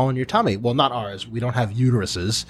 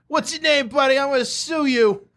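mock whiny victim; 12 semitones higher than usual. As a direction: ham up a fake injured guy demanding a name. Mock lawsuit threat after falling.

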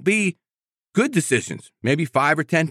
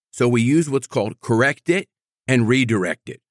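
usual speaking voice

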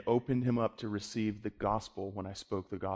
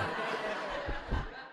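breathy laugh; 8 dB quieter than usual. Real, not acted. Laugh after own joke.

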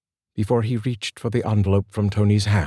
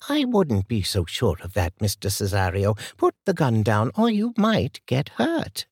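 put-on higher, steady older lady's voice; composed older woman Martha; 4 semitones higher than usual. A narrator's reading. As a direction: calmly warn the gunman as an old lady.

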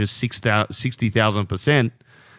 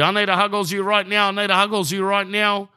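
put-on higher, fast little-kid voice, pleading; imitating little daughter; 9 semitones higher than usual. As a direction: play the toddler begging for a cuddle at dawn. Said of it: "The little one wants to huggles me".

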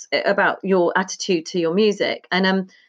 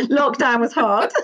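laughing; 4 semitones higher than usual. Real, not acted. Laughs around a rueful marriage joke.

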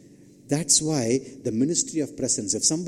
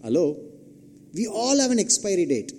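usual speaking voice

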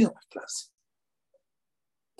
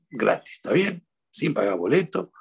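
usual speaking voice

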